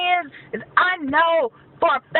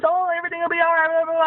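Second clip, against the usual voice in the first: mocking commenters; faster than usual. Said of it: "don't leave me in comments".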